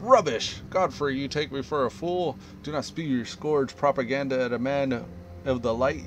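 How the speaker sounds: scornful inquisitor. Acted. Inquisitor rejecting the mayor's lies, angry outburst. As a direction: scoff and threaten a heathen. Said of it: "The Inquisitor said".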